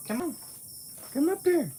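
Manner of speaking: squeaky high encouragement to the cat; high pet-talk coaxing. Real, not acted.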